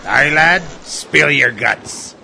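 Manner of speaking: booming pirate bosun, slow; loud pirate voice. Acted. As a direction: bellow it like a sea dog. Bosun urges the lad on.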